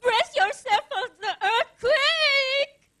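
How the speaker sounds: mock kids' jeering, building to a high, loud yell; mocking singled-out taunt, ending in a drawn-out shout; taunting schoolkids. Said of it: "how kids at school said I was fat".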